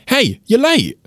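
calling out. Pretend. Translating the yell to a mate, mock calling out. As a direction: call it out to a late friend. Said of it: "yelling at your mate".

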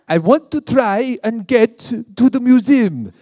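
loud, high, slow mock French accent; hammed-up French accent, drawn out. Shown as a demonstration. British tourist faking French to the locals, in a loud French accent. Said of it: "say it in a French accent".

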